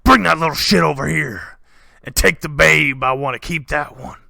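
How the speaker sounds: a bandit chief breaking into a house; bandit king. Acted. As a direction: bellow to his men. Bandit king orders men.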